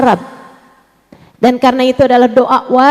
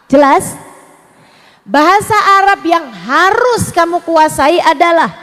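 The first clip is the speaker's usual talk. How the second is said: ordinary delivery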